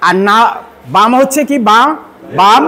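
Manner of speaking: ordinary talk, nothing marked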